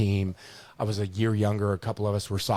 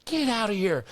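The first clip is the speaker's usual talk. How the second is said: coach ordering out; 11 semitones higher than usual. Retold or imitated. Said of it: "He just goes".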